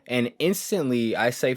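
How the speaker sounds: ordinary talk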